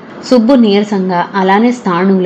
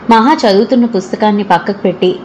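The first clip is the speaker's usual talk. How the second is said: ordinary delivery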